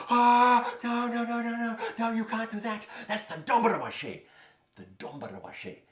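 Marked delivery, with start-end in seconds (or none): 0.1-4.2 put-on high, loud chorus of children crying no; alarmed children. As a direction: shout the warning high like frightened kids. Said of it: "the other children said".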